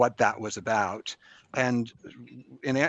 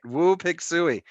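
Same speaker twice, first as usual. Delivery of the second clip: hog call cheer; 7 semitones higher, 5 dB louder, slower than usual. Retold or imitated. Arkansas Razorback hog call.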